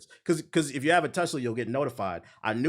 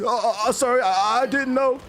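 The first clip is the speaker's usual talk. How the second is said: higher, flustered imitation of a caught neighbor; flustered apology; 10 semitones higher, 4 dB louder than usual. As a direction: play the scared guy backing down, a bit hammed-up.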